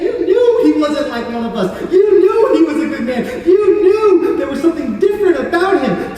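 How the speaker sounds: loud, high, almost shouting in awe; awed exclamation. Acted. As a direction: cry it out with a laugh of wonder. Awed thief describing Jesus.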